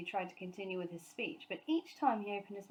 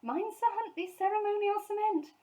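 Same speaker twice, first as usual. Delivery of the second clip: much higher, louder alarm; urgent warning; 13 semitones higher, 6 dB louder than usual. A narrator's reading. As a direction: call out in a panic. Mrs Brown's warning continued.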